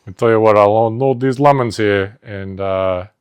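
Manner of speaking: loud, hammy Jersey Italian workers; Jersey Italian tough guys. Acted. Two grocery workers bonding, loud hammy Jersey Italian accent. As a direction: ham it up as gabagool-loving tough guys. Acting out supermarket Jersey skit.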